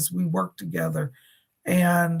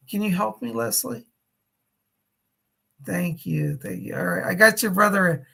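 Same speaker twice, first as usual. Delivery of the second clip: ordinary talk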